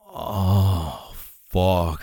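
ordinary delivery

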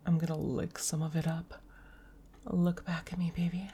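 ordinary talk, nothing marked

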